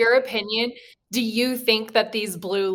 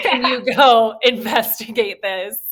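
retold order broken up by laughter; laughing mock order. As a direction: laugh through the pretend military request. Joking retold military order, laughing.

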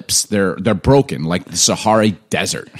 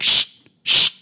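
librarian shushing, voiceless; a strict librarian's hush; library whisper; 4 dB louder than usual. Retold or imitated. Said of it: "turn into the librarian and be like".

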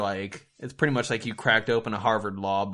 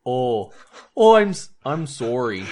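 mock-Canadian apology with an Irish lilt; Canadian accent; 6 dB louder, slower than usual. Shown as a demonstration. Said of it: "how you do a Canadian accent".

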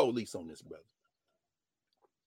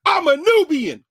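proud declaration; 13 dB louder, slower than usual. Retold or imitated. Man proudly announcing himself.

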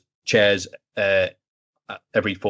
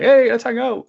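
loud, high, hammed-up eager call; mock your own puppyish keenness; overfriendly invitation; 12 semitones higher, 7 dB louder, slower than usual. Acts out his overfriendly invitation.

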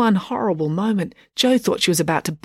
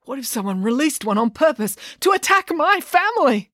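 high, anxious, panicky father; frantic worry; 8 semitones higher than usual. A narrator's reading. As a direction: fret it out in a rising panic. Dad, paranoid and frantic.

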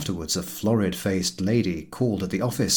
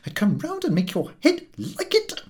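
sudden threat; 11 semitones higher than usual. Acted. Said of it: "with a suddenness".

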